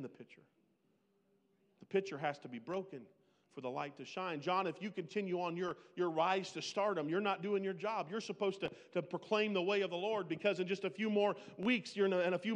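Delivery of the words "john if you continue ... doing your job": ordinary delivery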